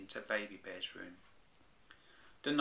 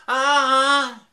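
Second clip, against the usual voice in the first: loud, much higher screaming ah; big scream; 22 dB louder than usual. Acted. The bears seeing the old lady, full scream. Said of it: "This is what really happened".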